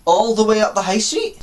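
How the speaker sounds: put-on dismayed query, rising at the end; incredulous echo. Lost traveller querying the woman's directions, incredulous.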